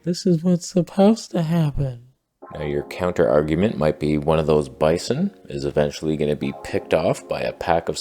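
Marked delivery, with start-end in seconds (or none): 0.0-2.1 echoed mother line. Acted. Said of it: "the mother says".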